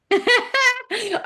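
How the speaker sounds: loud, high giggle; real amused laugh; high burst of laughter. Real, not acted. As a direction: break into a bright laugh. Laughs at her own point.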